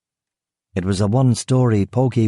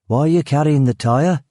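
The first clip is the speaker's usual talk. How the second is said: guard's voice; 6 semitones higher than usual. Acted. Suspicious guard questions stranger.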